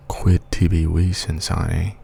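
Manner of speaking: hushed ASMR recitation, calm and close; ASMR Latin. Shown as a demonstration. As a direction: murmur the Latin gently and slowly, ASMR style. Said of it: "we'll do some A S M R Latin".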